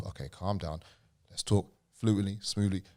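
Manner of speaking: soft, hushed talk to himself; calming self-talk. Retold or imitated. A stammerer quietly talking himself down before speaking. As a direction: coax yourself quietly into calm. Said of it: "I sometimes have to".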